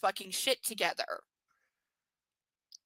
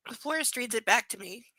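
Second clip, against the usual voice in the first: normal talking voice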